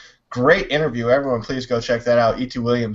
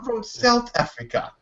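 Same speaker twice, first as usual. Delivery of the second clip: higher, lightly put-on English-sounding accent; a hint of a South African accent, said a bit softer; mock South African accent; 9 semitones higher, 4 dB quieter than usual. Schoolmate insisting he's from Africa, mild South African accent.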